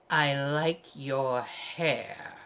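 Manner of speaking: low, very slow forced niceness; hateful compliment. A narrator's reading. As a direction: drag out each word with loathing. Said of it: "gives him the ugliest look I've ever seen".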